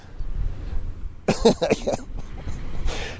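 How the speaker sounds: long breathy chuckle; quiet laugh. Real, not acted. Still amused by the Karen, a soft laugh. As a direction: let out a hushed laugh.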